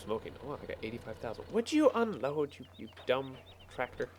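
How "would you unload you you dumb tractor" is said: high, pleading jab at the tractor; exasperated. Real, not acted. Player nagging his stuck tractor, exasperated. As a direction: nag the stuck machine, pitched up.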